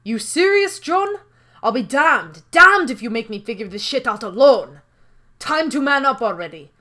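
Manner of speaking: loud, exasperated country partner; fed-up rustic character, forceful; irritable John. Acted. Irritated John scolds his partner.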